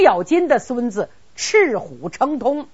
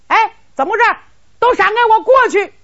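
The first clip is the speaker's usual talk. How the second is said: higher, louder, fast commanding shout; impatient shout; 11 semitones higher, 5 dB louder, much faster than usual. Acted. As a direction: Qin Ying yells for the troops to clear the road. Qin Ying shouts at blocking troops. (不耐烦地高声喝令)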